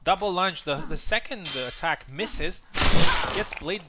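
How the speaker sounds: a burst of live play-by-play energy; excited call. Real, not acted. Live call of double lunge.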